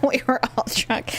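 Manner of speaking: laughing. Real, not acted. Laughing while telling.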